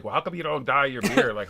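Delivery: usual speaking voice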